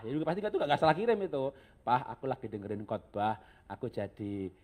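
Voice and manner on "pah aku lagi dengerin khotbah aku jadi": soft, low, a little formal and hesitant; restrained, slightly pious. Acted. Wife cautiously messaging her husband after a sermon, restrained and slightly pious. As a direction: gently feel your way into a devout message. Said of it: "Yang agak-agak mesti agak resmi-resmi dikit, agak rohani-rohani dikit".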